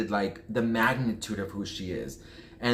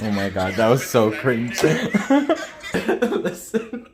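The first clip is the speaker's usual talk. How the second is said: cringing at oneself, breaking into laughter; embarrassed laughing; 5 dB louder, slower than usual. Real, not acted. Vlogger watching their own fan encounter back, embarrassed laughing.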